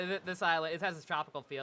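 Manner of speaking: ordinary talk, nothing marked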